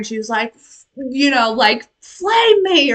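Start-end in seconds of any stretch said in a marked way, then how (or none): 2.0-2.9 half-hearted heroine cry; retold yell. Retelling the heroine's cry to the dragon, mild mock yell. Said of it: "she just yelled at the dragon".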